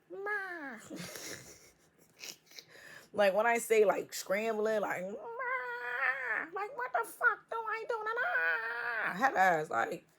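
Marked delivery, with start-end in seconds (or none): 0.1-1.0 high squeaky cry of Ma; mock call for mom. Panicking somebody calling for mom. 5.0-9.2 high, crying mock panic. Karmic person scrambling, calling mom in high panic.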